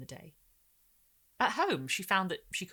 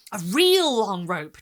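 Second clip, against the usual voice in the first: slow and loud, stretched out; relishing child; 3 semitones higher, 12 dB louder, much slower than usual. Acted. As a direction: savour every word. Girl adding relish.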